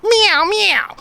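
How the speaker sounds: quick high cat meow. Retold or imitated. Man mimicking a cat that tries to bark, loud high meow. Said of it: "he's like".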